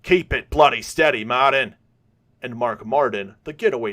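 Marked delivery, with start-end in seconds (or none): 0.0-1.7 loud Australian shout over the wind; louder shout to the driver; yelling. A narrator's reading. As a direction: yell it out of a speeding car window. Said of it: "He yells out".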